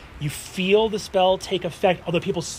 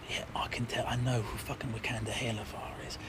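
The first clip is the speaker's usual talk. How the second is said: low, very soft grumble; hushed, dismissive lordly muttering; 5 semitones lower, 13 dB quieter, faster than usual. Acted. Casimir answers the knight.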